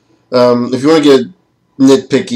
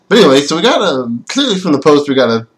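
ordinary talk, nothing marked

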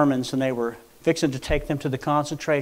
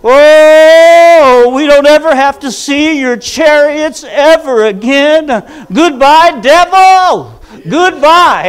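much higher, louder, slow jubilant cry; ecstatic shouting; 12 semitones higher, 17 dB louder, much slower than usual. Retold or imitated. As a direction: shout with wild joy at the sea's edge. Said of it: "somebody started going like this".

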